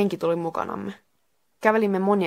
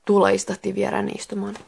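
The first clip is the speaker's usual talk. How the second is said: ordinary delivery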